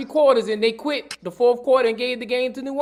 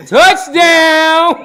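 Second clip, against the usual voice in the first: shouting; 5 semitones higher, 8 dB louder than usual. Real, not acted. Celebrating shout after hat joke.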